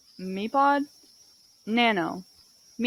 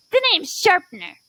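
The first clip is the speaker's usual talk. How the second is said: high voice, pitched up; higher-pitched character; 6 semitones higher, 4 dB louder than usual. Acted. Sharpener giving the name, high-pitched. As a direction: introduce yourself in a raised, high voice. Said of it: "She sounds like Fanny, but higher pitched".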